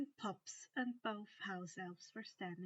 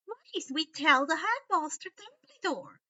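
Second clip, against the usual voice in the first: high, loud elf plea; insistent house elf; 6 semitones higher, 10 dB louder than usual. A narrator's reading. Elf urges Harry, high and loud. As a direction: press hard to tell the headmaster.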